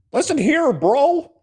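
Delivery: put-on high, loud caricature of a Michigan accent; hammed-up Michigan folksiness in a squeaky-high accent; mock folksy Michigan accent. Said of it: "do that accent, that folksy Michigan thing".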